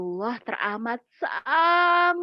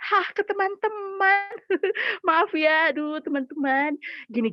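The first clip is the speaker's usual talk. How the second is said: normal talking voice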